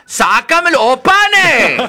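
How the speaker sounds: high, loud, slow drawn-out plea; a magic oven crying out to be emptied; oven's pleading cry. Acted. Oven calls to the girl to take out the bread, high and stretched.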